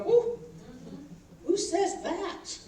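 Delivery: high, startled "Woo", then a slow, puzzled "who says that?"; mock shock at your own mouth; startled exclamation. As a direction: catch yourself off guard mid-sentence.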